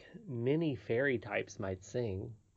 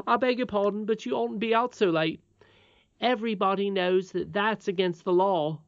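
put-on higher-pitched boy, earnestly admonishing; frank miner boy; 8 semitones higher, 5 dB louder than usual. Miner boy warning the princess, frank and earnest. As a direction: play a young miner correcting his betters.

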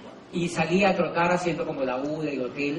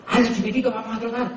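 playful invitation; 5 semitones higher, faster than usual. Retold or imitated. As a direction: egg him on playfully to jog along. Cheering on stranger while jogging. (invitación juguetona y animada)

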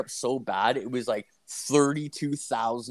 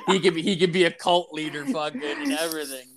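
higher, giggly riffing; laughing; 5 semitones higher than usual. Real, not acted. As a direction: get the joke out through laughter. Riffing through laughter with co-host.

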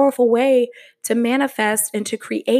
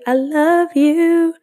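higher, louder, slow drawn-out I love you; gushing final i love you; 8 semitones higher, 6 dB louder, much slower than usual. Real, not acted. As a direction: stretch it out big and high like a happy farewell. Final drawn-out sign-off to listeners.

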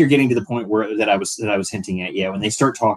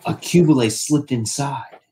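mock dramatic report; 6 semitones higher, slower than usual. Reading the translated line, acted.

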